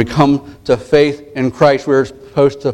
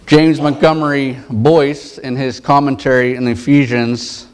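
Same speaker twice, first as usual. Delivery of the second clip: ordinary talk, nothing marked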